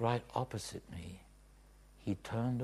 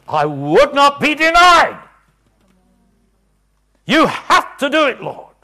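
high, very loud demand; fierce shout; 24 dB louder than usual. Retold or imitated. Preacher demanding God act, fierce shouting. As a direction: refuse to be denied, full force. Reliving intense demanding prayer.

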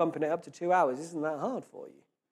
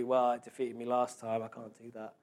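defeated inner voice; 5 semitones lower, 5 dB quieter than usual. Retold or imitated. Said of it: "we're gonna lose easier next time".

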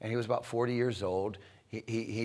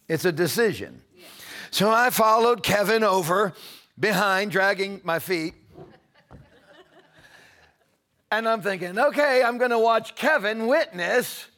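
loud, high, soaring preacher's cry; preaching at full force; 9 semitones higher, 8 dB louder than usual. Acted. Preacher lifting the congregation into prayer, full force. Preaching decision, retelling story.

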